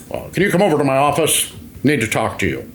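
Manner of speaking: brisk, put-on boss voice, starting high; Father Hayes. Priest boss calling a worker over to his office, brisk impression. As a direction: imitate a priest boss summoning someone. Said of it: "Father Hayes im-, impression".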